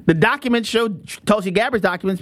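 usual speaking voice

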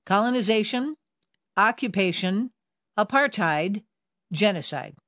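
each word spaced out with a clear pause; measured, separated list ending on a falling close; slow, word by word. Shown as a demonstration. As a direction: say the list slowly, letting each term land. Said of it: "I'm gonna say them slowly".

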